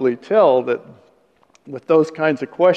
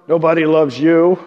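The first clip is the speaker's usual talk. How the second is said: joking mutter; 5 dB louder than usual. Retold or imitated. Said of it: "That's the kind of muttering".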